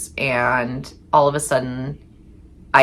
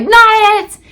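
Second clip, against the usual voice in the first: squealed, much higher and louder playground chant; high mock call-out; 9 dB louder than usual. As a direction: yell it fast like kids dodging a chore. Everybody shouts the game call.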